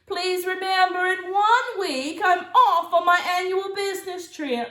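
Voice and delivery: aunt's grand loud announcement. Acted. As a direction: proclaim it like a theatrical aunt. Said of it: "Auntie Claus announced".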